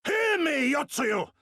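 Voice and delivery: high, loud demand to be heard; ringing shouted challenge; commanding shout. Acted. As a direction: bellow to seize her attention.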